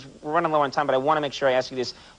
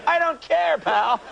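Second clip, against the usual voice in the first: gruff cashier; 14 semitones higher, slower than usual. Retold or imitated. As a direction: brush him off like a bored cashier. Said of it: "she's like".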